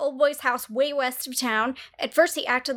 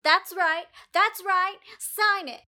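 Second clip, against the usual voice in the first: hammed-up thug voice, higher; tough girl impression; 6 semitones higher, slower than usual. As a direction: bob along like a tiny tough girl. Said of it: "The tough girl impression".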